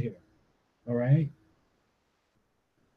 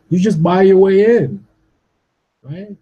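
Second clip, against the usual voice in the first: angry outburst; 4 semitones higher, 6 dB louder than usual. Real, not acted. Angry rant at critics.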